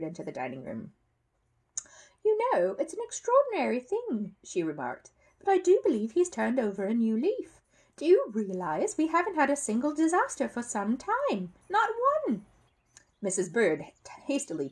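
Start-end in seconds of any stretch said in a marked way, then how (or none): 2.2-4.4 much higher lady's voice; Mrs Brown's voice. A narrator's reading. Mother muses to the family about the bear, high-voiced. 5.4-12.5 much higher lady's voice; Mrs Brown's voice. A narrator's reading. Mother muses to the family about the bear, high-voiced.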